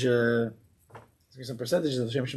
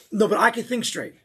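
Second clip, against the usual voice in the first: high, loud indignant protest; mock angry insistence; 7 semitones higher, 4 dB louder than usual. Angry man denying he's angry, raised and heated.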